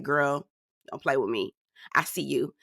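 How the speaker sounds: low, quiet warning; imagined warning look. Retold or imitated. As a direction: voice her silent warning, low and soft. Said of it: "She was looking like".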